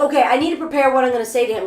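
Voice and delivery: racing through it very fast; rushed inner thought. Retold or imitated. Rehearsing in a panic before facing the conductor, very fast. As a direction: gabble it out in a panic. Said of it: "I'm like".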